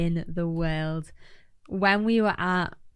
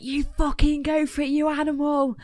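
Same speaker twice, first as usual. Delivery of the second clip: a higher, hyped-up cheer; playful rowdy encouragement; mock cheer; 7 semitones higher than usual. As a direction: imitate friends egging on. Retold supporters swearing encouragement.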